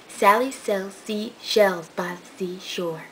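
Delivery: slow, careful tongue twister read; demonstrating the twister at half speed; slow demo. Shown as a demonstration. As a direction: spell out each word slowly for the viewer. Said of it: "Say it ten times fast".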